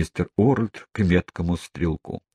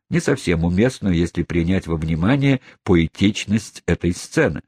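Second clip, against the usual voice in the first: ordinary delivery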